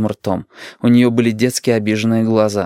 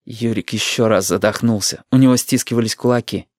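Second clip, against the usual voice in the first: ordinary delivery, nothing marked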